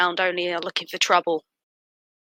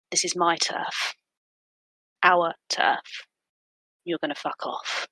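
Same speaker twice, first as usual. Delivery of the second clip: quiet menace; 5 dB quieter than usual. Acted. Threat grows low and quiet.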